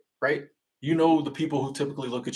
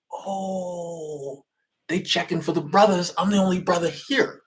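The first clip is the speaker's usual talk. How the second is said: drawn-out, higher "oh" of dawning realization; 6 semitones higher, slower than usual. Retold or imitated. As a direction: act out catching on, pitched up. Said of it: "And I'm like".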